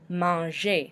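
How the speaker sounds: Manger as French mahn-ZHAY; silent final R. Shown as a demonstration. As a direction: say Manger ending in an AY, no R. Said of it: "we do not pronounce the R".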